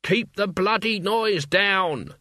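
slow, high, drawn-out cry of irritated monks. A narrator's reading. Monks crying out at noisy pilgrims, slow and high. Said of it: "the monks would cry unto them".

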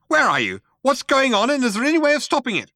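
frantic high quacked questions; quacking. A narrator's reading. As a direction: rattle them out like a furious penguin. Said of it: "he quacked".

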